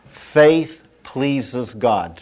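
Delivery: very slow, spaced word by word; emphatic declaration. Shown as a demonstration. Preacher models a line for the congregation, slow and emphatic. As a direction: state it slowly as a line to be repeated. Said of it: "Say that with me".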